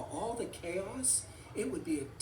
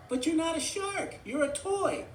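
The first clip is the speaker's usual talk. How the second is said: high, protesting toy voice; high-pitched cartoon objection; insistent Forky; 10 semitones higher, 4 dB louder than usual. A narrator's reading. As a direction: argue back in a high pitch. Forky argues with shark.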